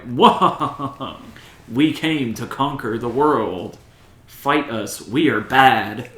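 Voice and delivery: hammed-up slow movie villain; mock menace, each phrase drawn out; cheesy villain. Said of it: "they're like, the villains in that movie".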